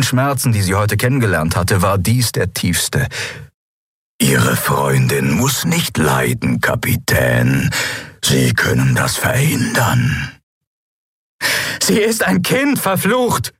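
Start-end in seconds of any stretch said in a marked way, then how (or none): 4.2-10.4 low, very slow drawn-out threat; slow, cold captor's voice; menacing villain. Acted. 11.8-13.5 high, outraged yell; high-pitched furious protest; angry scream. A narrator's reading. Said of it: "schrie Lien die Schatten an".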